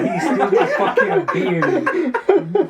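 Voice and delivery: very high, slow, laughing exclamation; laughing hard. Real, not acted. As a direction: crack up while getting the words out. Cracking up at friend's joke.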